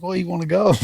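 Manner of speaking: high, cocky dare; challenge to fight. Retold or imitated. Squaring up to the getaway driver, high cocky dare. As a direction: toss out the fight offer like a dare. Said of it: "I'm like".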